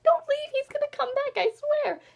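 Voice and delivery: very high-pitched plea; hammed-up desperate insistence; desperate pleading. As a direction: beg them to stay, voice way up high. Acts out her plea to readers.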